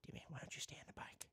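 very quiet, quick whisper; whispered plea. Pretend. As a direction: whisper it fast under your breath. Muttered plea to game character.

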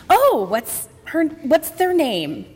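slow, drawn-out curious question; curious mentor. Retold or imitated. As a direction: draw out a high 'oh', then ask slowly. Mentor asks about the new crush.

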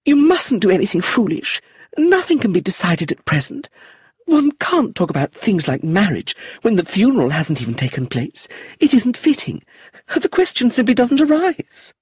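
fretting aunt forbidding the marriage; agitated protest. Acted. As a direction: protest in a fluster. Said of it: "Lucilla cried".

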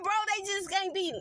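normal talking voice

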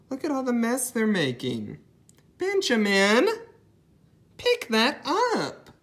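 put-on high-pitched mom scolding child; scolding mother. Voicing puzzle's scolding parent.

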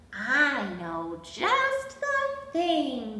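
plain reading voice

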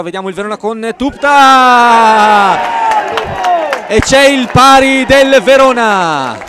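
loud, slow goal call; goal shout. Real, not acted. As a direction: yell out the equaliser. Commentator calls the equaliser.

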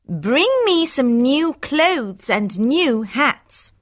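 high, slow, coaxing daughter voice; wheedling Hortensia. Acted. Daughter wants presents from father, wheedling. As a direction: draw it out to coax him.

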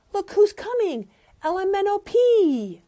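high, loud, eager; excited announcing. A narrator's reading. Teacher reading to kids, announcing arrivals excitedly. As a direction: call out the new arrivals.

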